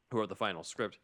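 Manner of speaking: ordinary talk, nothing marked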